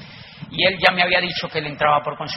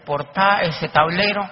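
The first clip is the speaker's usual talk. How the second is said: loud, laughing at his own humiliation; laughing embarrassment; 6 semitones higher, 8 dB louder than usual. Real, not acted. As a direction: laugh it off, louder and higher. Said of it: "me sentí tan chiquitito". (riendo avergonzado, más fuerte y agudo)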